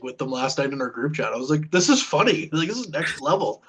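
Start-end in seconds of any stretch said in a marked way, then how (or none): 1.7-3.6 high-pitched, half-laughing insistence; retold exasperation. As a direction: replay your mock outrage that nobody finds it funny. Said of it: "I was visibly mad about it".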